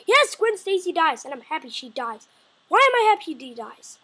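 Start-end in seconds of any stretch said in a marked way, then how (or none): 0.1-1.2 loud proud confirmation, "Yes" stressed; loud declaration; emphatic loud. Acted. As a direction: boom it out as a reveal. Whispered then repeated aloud.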